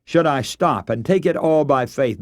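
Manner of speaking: normal talking voice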